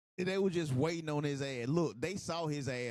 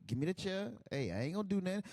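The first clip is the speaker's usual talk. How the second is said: ordinary talk, nothing marked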